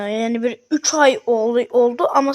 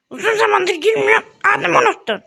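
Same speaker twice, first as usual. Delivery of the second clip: higher, lightly voiced hurt friend; offended pet voice; 8 semitones higher, 3 dB louder than usual. Pet Muhittin sulking at his owner, pitched up. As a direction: play the pet complaining, pitched up.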